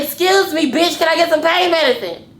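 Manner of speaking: loud, high-pitched irate demand; going off. Retold or imitated. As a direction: re-enact blowing up at staff who ignore you. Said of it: "I was literally going off on them".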